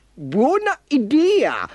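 high, slow little-car voice; Vivi. A narrator's reading. Little car approving the rescue plan, high and drawn out. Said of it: "disse Vivi".

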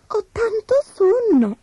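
high, drowsy little sigh of a line. Acted. Tired girl alone before the beds, sighing. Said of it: "sospirò la bambina".

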